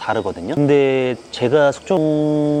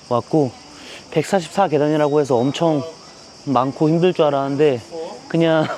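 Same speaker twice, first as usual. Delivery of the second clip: normal talking voice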